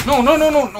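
high, loud run of panicked cries; panicked shouting. Real, not acted. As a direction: shout in sudden alarm, pitch shooting up. Panicking under fire in game. (gritos agudos de pánico)

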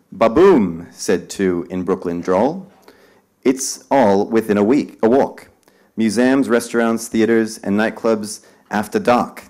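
loud, slow, dramatic poem reading; spirited verse reading for a crowd; poem recital. Acted. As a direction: declaim it to the room like a performance. Reads poem aloud to audience.

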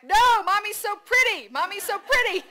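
high, very loud, insistent shout; out-shout a toddler with the correction; shouting back. A narrator's reading. Mother shouting back at toddler while biking, high insistent shout. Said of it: "And me shouting back".

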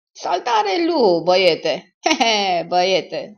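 loud, drawn-out greeting with a chuckle; hearty hail. Acted. Visitor Elmer greets his pal at the door, loud hearty hail.

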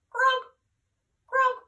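high, playful croak; frog croak. Pretend. Teacher showing the kids a frog croak. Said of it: "And now you're a frog".

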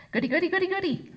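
high, excited chant; delighted little girl. A narrator's reading. Little girl cheering for cookies, gleeful. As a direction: squeal it like a thrilled toddler. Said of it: "She hopped up and down with delight".

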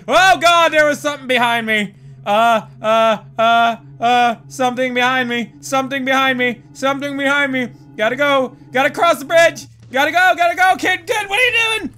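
high, fast, frantic repetition; panicked. Real, not acted. Player fleeing something behind them, frantic panic. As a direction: panic rising into a loud squeal.

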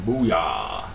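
normal talking voice

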